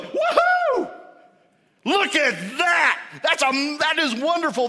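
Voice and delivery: high, loud whoop of celebration; jubilant cheering. Acted. Preacher acts out heaven celebrating a repentant sinner, jubilant shouting. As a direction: whoop and exult at full volume.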